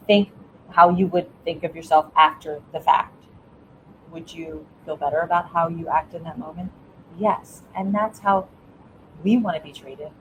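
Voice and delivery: normal talking voice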